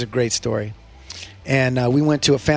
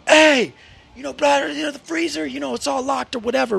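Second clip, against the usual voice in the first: lightly voiced father; 9 semitones higher than usual.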